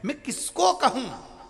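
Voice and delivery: high, pleading wail; helpless cry. Acted. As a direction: cry out with no one to turn to. Ganesh's helpless lament.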